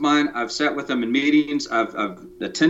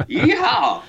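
whooping cowboy cry, pitched way up; high comic cowboy whoop; yeehaw; 3 dB louder than usual. Acted. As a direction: let out a goofy rodeo yeehaw. Joking in cowboy voice.

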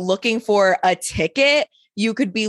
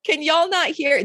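mock exasperated yell; 6 semitones higher than usual.

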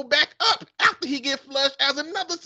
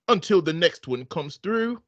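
put-on man's British accent; 7 semitones lower than usual. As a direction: read it like a smug English gentleman. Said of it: "man and a woman accent".

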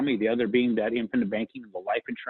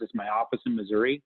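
usual speaking voice

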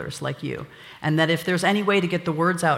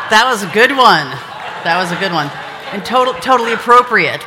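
loud, raised praise over laughter; loud approval; 4 semitones higher, 9 dB louder than usual. Real, not acted. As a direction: call out your delight at the joke. Cheering joke over audience laughter.

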